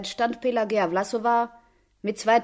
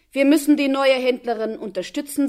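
loud, higher announcement; loud remark; 4 semitones higher, 8 dB louder than usual. A narrator's reading. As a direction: say it for everyone to overhear. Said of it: "sagte er laut".

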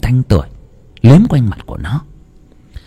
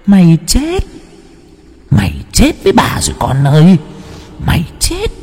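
high, eerie ghost-woman voice, gloating; a vengeful spirit savoring her catch; ghost woman's taunt; 8 semitones higher than usual. Acted. Ghost woman taunts the sleeping boy, high eerie gloating. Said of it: "người đàn bà cười lên khanh khách".

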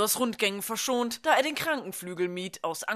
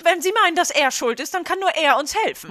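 sharp retort; 7 semitones higher, 4 dB louder than usual. Acted. Nurse answers teacher sharply.

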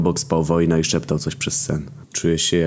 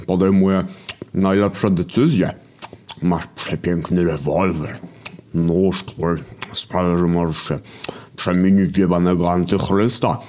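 hunger creature. Acted. Głód character, taunting.

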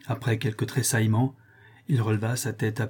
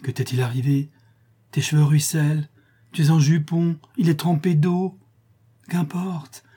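higher, mounting astonishment; astonished exclamation; 5 semitones higher, 4 dB louder than usual. A narrator's reading. Man notices his lover's soaked clothes, astonished exclamation. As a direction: exclaim in growing concern. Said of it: "il s'écria".

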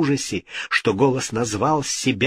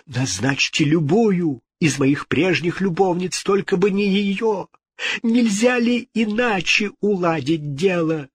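high-pitched bargaining; desperate pleading; 7 semitones higher than usual. A narrator's reading. King bargaining with the ghost, desperate. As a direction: beg high for another choice.

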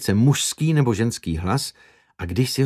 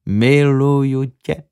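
slower, louder miluju tě with a rising end; tender retake; 2 semitones higher, 3 dB louder, slower than usual. Acted. Another take to make the loving look believable, slow and tender. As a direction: stretch miluju tě out slowly, lifting the end. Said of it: "když to šeptal snad posté".

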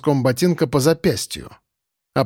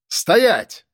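shouted command; 6 semitones higher than usual. Acted. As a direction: yell it to stop him. Someone blocks the way ahead.

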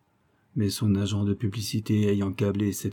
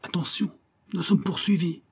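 urgent cry; 8 semitones higher than usual. A narrator's reading. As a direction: call out the alarm in a high, restrained voice. (cri d'alerte aigu mais retenu)

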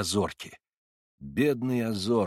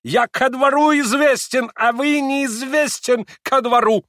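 high, loud, slow affronted shout; indignant German accent; 7 dB louder, slower than usual. A narrator's reading. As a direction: boast it in broken Russian, furious. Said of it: "прибавил он".

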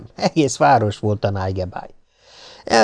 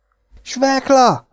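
feigned delight; 13 semitones higher, much slower than usual. Acted. Piki pretending to be thrilled at meeting the big boy, high and slow. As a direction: exclaim the name much higher, slow, in put-on joy. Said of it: "kiáltott fel tettetett örömmel Piki".

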